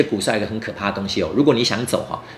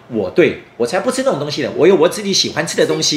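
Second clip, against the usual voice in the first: fake madman; 6 semitones higher, 5 dB louder than usual. Feigning madness, refusing food.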